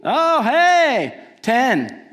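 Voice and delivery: hammed-up proud parent; cheering parent. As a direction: celebrate like you won.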